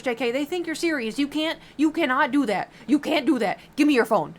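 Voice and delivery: high, loud mock telling-off; snatch his phone away in a loud, mock-furious rant; pretend angry scolding. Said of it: "I'd be pissed".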